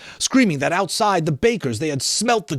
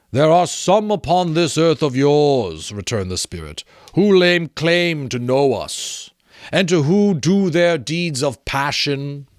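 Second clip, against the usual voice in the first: solemn spirit; slower than usual. Acted. The Ghost of Christmas Present rebukes Scrooge, slow and solemn. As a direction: intone it like an ancient spirit.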